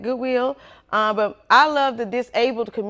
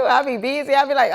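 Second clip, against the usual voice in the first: ordinary talk, nothing marked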